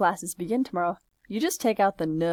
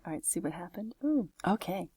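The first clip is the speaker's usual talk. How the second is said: whispering; 7 dB quieter than usual. Real, not acted. Whispered after a mistake.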